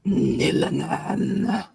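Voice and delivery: slow, drawn-out creature voice; lightly voiced dragon. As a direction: let the beast in the crate say its name slowly. Dragon voice names itself.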